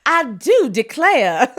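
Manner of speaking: slow, laughing Southern belle drawl; Southern accent. Retold or imitated. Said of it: "My Southern accent isn't great".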